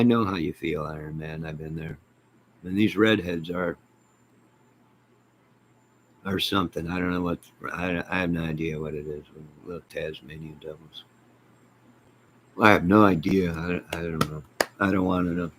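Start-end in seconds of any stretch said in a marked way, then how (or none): none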